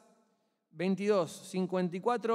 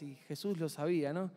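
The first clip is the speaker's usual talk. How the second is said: ordinary delivery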